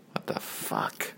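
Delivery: stunned, barely voiced; shocked whisper. Real, not acted. Whispering to the camera in disbelief at the church scene. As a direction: breathe it out in a hushed whisper.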